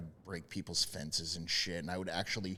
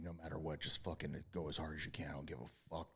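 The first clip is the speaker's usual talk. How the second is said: quiet self-talk; 4 semitones lower, 10 dB quieter, faster than usual. Retold or imitated. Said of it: "I tell myself, I'm like".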